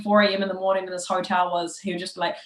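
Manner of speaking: ordinary delivery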